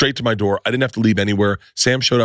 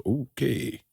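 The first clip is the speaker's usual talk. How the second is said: soft, knowing okay; a hushed, mysterious okay; mock cryptic; 9 dB quieter than usual. Gary's cryptic reply in story.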